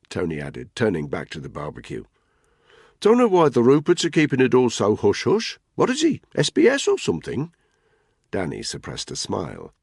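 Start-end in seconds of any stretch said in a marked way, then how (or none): 3.0-7.5 higher, louder bloke ribbing a mate; Tony's mocking voice. Tony teases Spud about his bike, mocking and raised. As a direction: needle him with a grin.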